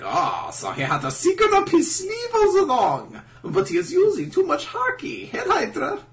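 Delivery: put-on very high, silly accented voice; hammed-up, squeaky mock character; Law. Law commenting on the secret up his sleeve, absurd high voice. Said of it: "And Law's like".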